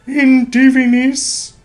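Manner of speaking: slow, high, loud churchly intoning; ecclesiastical Latin voice. Pretend. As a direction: intone it like a priest at mass. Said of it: "my ecclesiastical, uh, Latin voice".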